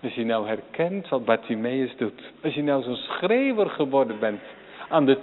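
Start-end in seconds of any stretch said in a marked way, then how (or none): none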